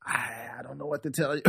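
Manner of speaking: very soft, hesitant retold answer; hushed helpless reply. Storyteller quotes himself answering her rant, hushed and helpless. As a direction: say it small and under the breath. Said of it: "And I'm like".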